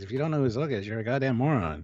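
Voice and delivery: ordinary delivery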